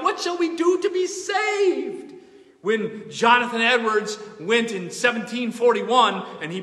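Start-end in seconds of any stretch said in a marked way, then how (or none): 0.0-2.1 much higher, loud anguished shout; crying out. Retold or imitated. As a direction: cry it out like a desperate crowd. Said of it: "The people cried out".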